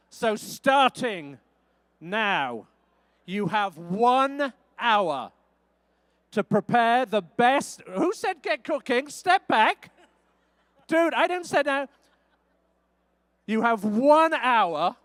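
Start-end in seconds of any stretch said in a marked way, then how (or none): 0.1-7.8 drawn-out game-show announcement; host starts the chefs' hour, slow and grand; slow dramatic countdown. Acted. Host starts the cooking clock. 13.5-15.0 host restates the time limit to the chefs, slow and grand; slow dramatic announcement. Acted.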